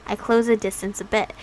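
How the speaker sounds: ordinary talk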